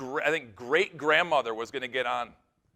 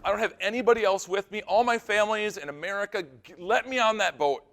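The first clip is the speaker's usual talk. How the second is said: high, urgent retold plea; pitched-up, imploring voice; pleading; 6 semitones higher than usual. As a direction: act out begging to be let through. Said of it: "She's pleading".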